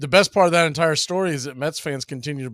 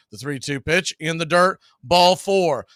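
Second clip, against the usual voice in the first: louder, higher excited call; play-by-play call; 5 semitones higher, 5 dB louder than usual. Acted. Announcer calling the walk to the stream, rising excitement. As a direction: build the call as runners reach.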